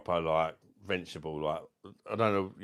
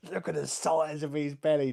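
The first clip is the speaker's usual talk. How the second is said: put-on loud little kid; 8 semitones higher, 4 dB louder than usual. Small child at pool telling dad about belly, high amazed voice. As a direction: blurt it out like a wide-eyed four-year-old.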